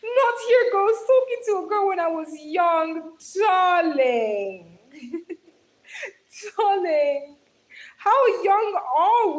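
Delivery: high, loud squealing read-out; excited shout. Real, not acted. Streamer reading a viewer's crush story, shouting with excitement. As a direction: shriek the comment out in delight. Reacting loudly to a viewer's confession.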